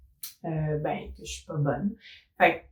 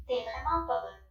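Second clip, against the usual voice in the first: robot voice changer, higher pitch; 5 semitones higher, 5 dB quieter than usual. Shown as a demonstration. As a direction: run it through a robot filter. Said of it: "Là, c'était en voix de robot".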